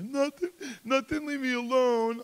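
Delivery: put-on high, loud tearful child's protest; pushing the older kid away through tears; upset little boy begging to be left alone; crying child. Child crying after towel thrown.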